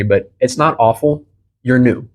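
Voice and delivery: ordinary talk, nothing marked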